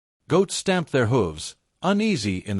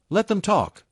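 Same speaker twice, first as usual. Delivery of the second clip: dismissive little scoff, pitched up; 8 semitones higher than usual. Acted. Young man brushing off elders, mild scoff. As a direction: brush off the old men's worry. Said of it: "a younger man scoffed nearby".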